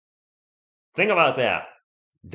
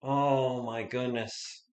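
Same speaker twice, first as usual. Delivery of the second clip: deflated; 8 dB quieter, much slower than usual. Real, not acted. After the missed winning shot.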